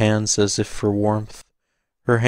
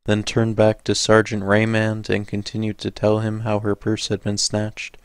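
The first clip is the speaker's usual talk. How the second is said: plain reading voice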